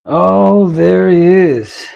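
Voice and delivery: loud, high, slow mock announcement; dramatic loud greeting. To the friend arriving, a loud theatrical greeting. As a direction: boom out his arrival with theatrical delight.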